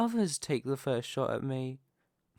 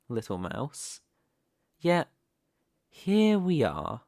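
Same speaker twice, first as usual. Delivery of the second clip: usual speaking voice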